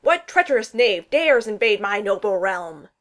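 loud, theatrical warrior's roar; bellowing. A narrator's reading. Thor roars in Steve's face at the intruder, bellowing. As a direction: boom it out like an affronted prince. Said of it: "Thor bellowed".

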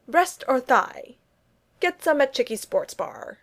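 slow, high suggestive ad read; cheesy ad slogan. A narrator's reading. Reading the sexy chicken shirt caption. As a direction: sell it like a cheesy bar ad.

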